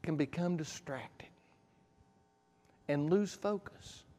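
hushed whisper for emphasis. Real, not acted. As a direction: lean in and whisper it. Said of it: "preacher drops to a whisper".

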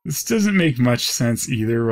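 ordinary talk, nothing marked

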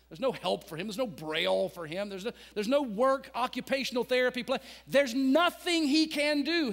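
high, loud building sermon; fiery preaching. Acted. Pastor drives the story home to the congregation, fiery preaching. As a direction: preach it to the rafters.

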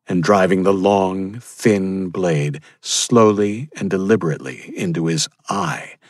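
plain reading voice

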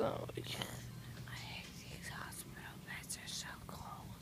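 soft unvoiced hospital whisper. Real, not acted. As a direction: whisper it so nobody wakes.

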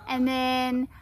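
ordinary talk

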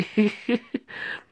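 high, airy laugh; exasperated laugh. Real, not acted. Reader fed up with a long book, exasperated laugh. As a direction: laugh breaking out mid-complaint. Laughs during own rant.

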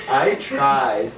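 normal talking voice

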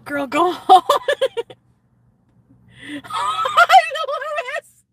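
loud, very high laughing shriek; cracking up. Real, not acted. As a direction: shriek the words through real laughter. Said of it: "you guys make me laugh".

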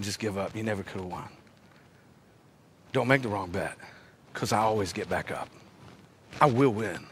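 very low and soft; hushed resolve. Retold or imitated. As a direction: say it quietly, sure of the outcome. Hushed doubt and answer, dramatic low point.

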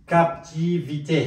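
syllable by syllable, rising at the end; very slow model. Shown as a demonstration. Teacher sounding out the new word for learners, very slow. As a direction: break the word into long, separate syllables.

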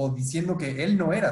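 usual speaking voice

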